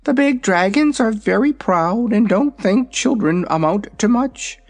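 high, proud little dragon; young dragon voice. Acted. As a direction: talk like a vain baby dragon. Dragonette speaks.

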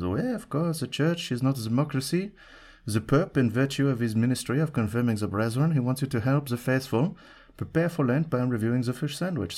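hammed-up French accent, lofty church official; put-on French envoy accent; ridiculous French accent. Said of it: "that's a ridiculous French accent".

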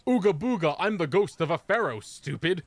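high mock ghostly voice; hammy spooky ghost boast; spooky pharaoh ghost.

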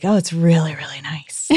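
hushed retold whisper; imitating him in a whisper; mock whisper. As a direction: whisper it like a secret. Retelling man's words, whispered.

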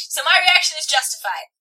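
usual speaking voice

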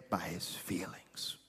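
hushed, breathy whisper for emphasis. Acted. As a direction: drop it to a whisper. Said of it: "drops to whisper for effect".

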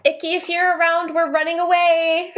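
high-pitched call to someone out of sight. Acted. Player calls to missing Icky as the group flees, calling out.

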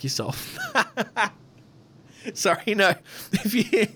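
cracking up mid-joke; laughing. Real, not acted. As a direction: laugh through the words. Jokey insult, laughs.

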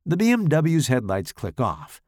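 usual speaking voice

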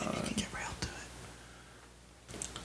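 slow, very soft whisper; hushed mock-ASMR tease. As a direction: whisper it slowly into the mic. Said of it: "We just whisper".

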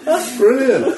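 laughing, high and loud; delighted laughter through the words; cracking up at the punchline. Real, not acted. Reacting to funny story.

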